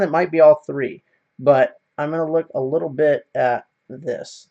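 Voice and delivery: ordinary delivery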